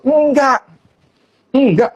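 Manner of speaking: real indignant no; emphatic no. Real, not acted. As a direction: shut it down firmly. Heated rant against Luhut.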